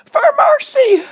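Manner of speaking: very high, loud, slow wail; full-force drawn-out scream of scripture; screaming to God. Retold or imitated. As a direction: cry out for help in long, held phrases. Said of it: "screaming to him".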